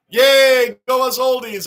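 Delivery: loud, high, delighted yay; excited cheer. Real, not acted. Streamer cheering older chat members, excited and loud.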